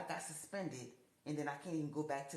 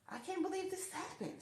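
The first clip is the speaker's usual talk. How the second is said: high, stunned voice at the news; stunned disbelief; 13 semitones higher than usual. Retold or imitated. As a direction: squeak out the disbelief. Retold disbelief at the theft.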